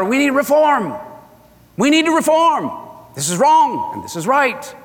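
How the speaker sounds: demanding reform. Retold or imitated. Voicing Christians demanding reform.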